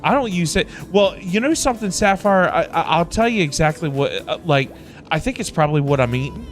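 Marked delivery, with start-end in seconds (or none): none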